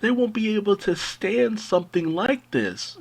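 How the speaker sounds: high-pitched mock worried parent; mock pearl-clutching, pitched well up; fretting parents. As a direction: imitate parents protesting on children's behalf. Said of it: "A lot of parents love to be like".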